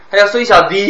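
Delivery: 孙小迪 said high and loud with comic stress; a playful wrong stress on the name; joking stress on 孙小迪; mock odd stress. Shown as a demonstration. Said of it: "他叫，他叫孙小迪".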